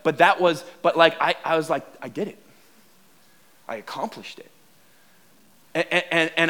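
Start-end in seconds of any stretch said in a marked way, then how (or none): 2.0-4.5 soft, low, private satisfaction; quiet pride. Retold or imitated. Rider privately proud, hiding it from the pastor, quiet pride. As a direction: say it low, as if only to yourself. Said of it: "sense of pride that was inside of me".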